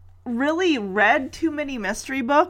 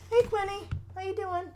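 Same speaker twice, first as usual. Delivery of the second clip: high, soft voice for the pet; pet talk; 9 semitones higher, 8 dB quieter than usual. Real, not acted. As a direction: coo at the pet. Greets pet, high sweet voice.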